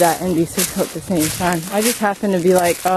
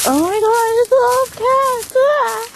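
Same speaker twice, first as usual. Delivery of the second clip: much higher, squealing mock-gush; gushing cat-crying girl. Mocking the YouTube girl who cries over cats, high gushing squeal. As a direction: parody an overemotional girl sobbing about cats. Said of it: "and she's like".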